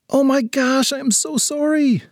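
high-pitched flustered apology; startled apology. Acted. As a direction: blurt it as if you just bumped someone. Girl apologizes after a collision.

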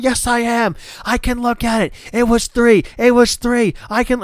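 high, insistent mock protest; arguing Jericho. Jericho pushing into the replay booth: insistent mock protest. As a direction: argue it like a whiny heel. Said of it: "they're arguing".

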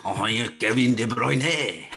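loud, drawn-out French-Belgian accent; mock Belgian accent. Shown as a demonstration. Co-host shows how he'd say it in Belgian, mock French accent. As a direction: put on a hammy French-speaking Belgian voice. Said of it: "how would you say it in a Belgian accent?".

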